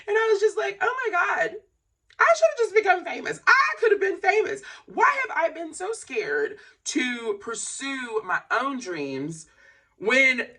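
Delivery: high, loud wailing lament; crying and laughing. Real, not acted. Lamenting the dreams never pursued, wailing half in laughter. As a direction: wail it loudly, on the edge of laughter. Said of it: "as I cry, as I laugh".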